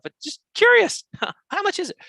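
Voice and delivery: mock sheepish caller. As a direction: play the timid buyer sneaking in the question. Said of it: "call them on the phone and say".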